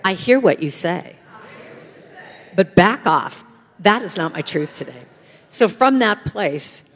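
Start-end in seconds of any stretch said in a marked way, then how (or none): 0.0-5.0 loud, firm lead for the room to repeat; defiant, louder affirmation; with attitude. Shown as a demonstration. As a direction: say it boldly so they echo you. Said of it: "One more time".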